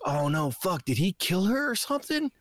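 rising panic as if she's been killed; alarmed. Retold or imitated. Retelling his fear for the girl in the movie, mock alarmed. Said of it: "I was like".

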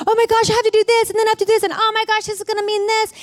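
high-pitched, frantic list of complaints; stressed-out ranting. Retold or imitated. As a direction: work yourself up, pitch held high. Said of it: "I was going on and on about".

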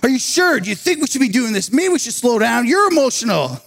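high, urgent retold objection; heated pushback. Preacher retells the graduate challenging his boss in a heated moment. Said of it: "it's a heated moment".

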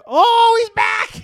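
high, loud delighted shout; excited shout. Real, not acted. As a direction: cheer him coming back. Guy returns on screen.

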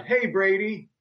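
high-pitched mock call across a quad; retold yell. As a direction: re-enact hailing someone from afar. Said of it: "I yelled across the quad".

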